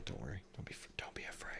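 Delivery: whispered mock comfort. Soothing his fallen phone stand, whispered. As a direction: hush it like soothing a scared pet.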